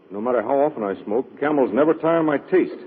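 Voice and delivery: normal talking voice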